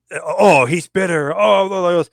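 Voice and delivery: high, loud mock whining about him; mocking complainers. Mimicking critics who call him bitter.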